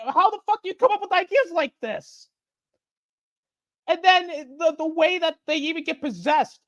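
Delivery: can't believe what you're hearing, rant it; incredulous rant. Real, not acted. Incredulous rant about the plot.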